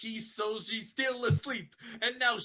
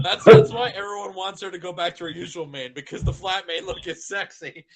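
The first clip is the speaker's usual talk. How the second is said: loud fast rant; 11 dB louder than usual. Acted. Rainbow ranting about manes.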